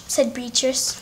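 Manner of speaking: ordinary delivery, nothing marked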